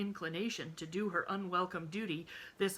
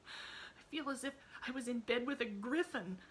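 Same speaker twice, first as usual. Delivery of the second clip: put-on higher child voice, wry and miserable; a girl's private complaint to herself; girl's inner thought; 7 semitones higher than usual. A narrator's reading. Emily thinks about her aunt, higher child voice. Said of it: "thought Emily".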